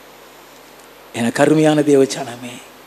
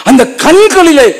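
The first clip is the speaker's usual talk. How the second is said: fervent preaching burst; 13 semitones higher, 8 dB louder than usual. Acted.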